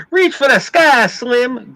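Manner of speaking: high, loud Western gunslinger drawl; hammed-up cowboy gunslinger accent. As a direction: call it out like a movie gunslinger. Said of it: "gunslinger accent".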